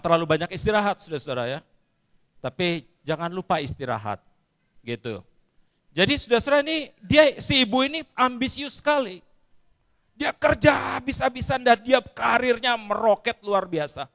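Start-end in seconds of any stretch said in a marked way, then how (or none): 5.9-14.1 high, loud preaching to the crowd; full-force preaching. Acted. Preacher rousing the congregation with the story. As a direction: drive the story forward like a pulpit.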